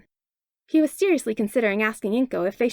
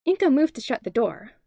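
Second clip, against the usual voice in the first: usual speaking voice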